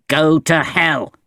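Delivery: slow, heavy menace; menacing curse. A narrator's reading. Valjean to the child, slow menacing voice. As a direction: drag it out like a threat.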